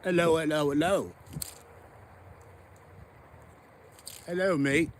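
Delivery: put-on a light, playful Australian accent on the greetings; Aussie accent. Ice fisherman greeting viewers, mock Australian accent. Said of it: "This is my Aussie accent".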